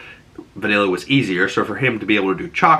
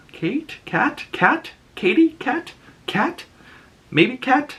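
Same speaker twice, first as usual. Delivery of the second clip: high questioning guesses at a name; unsure guessing; slower than usual. Real, not acted. Vlogger unsure of a name, high guessing. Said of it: "I think this girl's name is Katie?".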